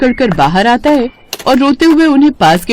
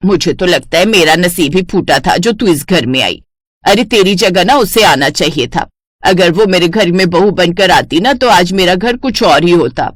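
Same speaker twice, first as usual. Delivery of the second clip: taunting mother-in-law; 2 semitones lower than usual. Acted.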